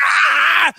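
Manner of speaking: high drawn-out "ah", imitated panic; mock alarmed yell. Imitating Dave leaning into the aisle, mock alarmed cry.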